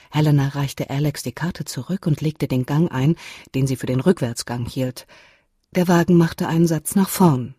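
ordinary delivery, nothing marked

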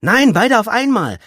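high, loud interjection; brash girl egging him on; loud crowing. A narrator's reading. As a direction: blare it out over the others. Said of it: "tönte das Mädchen".

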